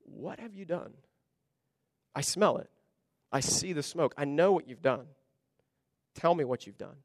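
quietly accuse, heavy and stern; somber, serious. Retold or imitated. Samuel confronts Saul over the smoke: hushed, somber. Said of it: "very somber, very serious look on his face saying".

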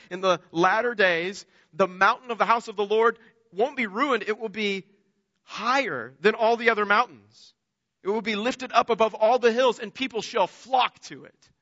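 preacher proclaiming a hopeful prophecy; scripture reading. Acted. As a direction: lift the promise up to the congregation.

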